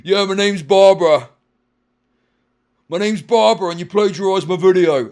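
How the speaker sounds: loud, hammed-up mock of a commenter introducing herself. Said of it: "and you sound like this".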